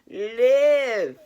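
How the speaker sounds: Live belted out loud and high, one long falling word; emphatic shout. Real, not acted. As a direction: shout the command to wake them up. Urging listeners to live fully.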